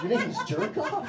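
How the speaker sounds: mock disbelief; shocked question. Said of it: "I'm like".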